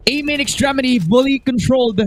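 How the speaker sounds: rising, excited play-by-play call; esports caster. Acted. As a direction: call the action as it happens. Live play-by-play.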